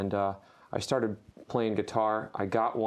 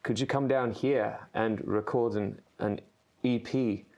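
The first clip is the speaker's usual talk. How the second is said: put-on South African accent. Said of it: "can you actually do that in a South African accent".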